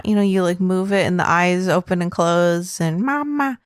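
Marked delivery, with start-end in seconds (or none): 3.0-3.6 high little toy voice; talking baby doll. Acted. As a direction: mimic a doll's two-syllable call, falling. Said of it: "they talk and they go".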